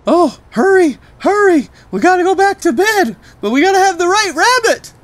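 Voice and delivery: put-on high, loud, fast little girl urging; frantic child. Trixie urging her daddy across the city, high, loud and fast. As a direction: rush like a frantic toddler.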